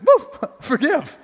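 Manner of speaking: high, slow, giddy "Woo, forgive"; hammed-up giddy feeling; mock Doughboy. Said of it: "Like the Pillsbury Doughboy".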